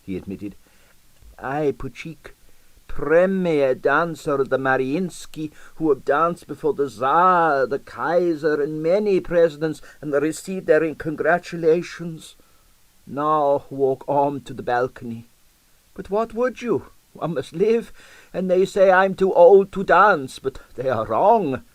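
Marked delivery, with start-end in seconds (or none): 1.4-17.9 put-on proud old Russian dancer. Old dancer boasting to the detective, in a high proud Russian voice. 18.3-21.6 a louder, higher insistent protest. Acted. Old dancer insisting he can still dance, louder. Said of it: "he said more loudly".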